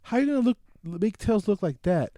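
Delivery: usual speaking voice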